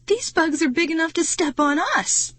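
high, incredulous objection; protesting. A narrator's reading. Marty to Aaron over stomping bugs, protesting. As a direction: keep the pitch up high in protest. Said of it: "he protested".

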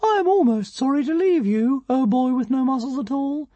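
high tiny dragon, mildly sorrowful; a touch of regret, tiny voice; Zigarastica, sorrowful. A narrator's reading. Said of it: "said Zigarastica sorrowfully".

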